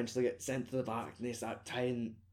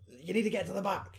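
mock shouting passenger; 6 semitones higher than usual. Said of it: "they all start shouting at them".

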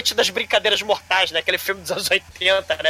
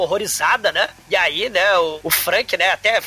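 ordinary talk

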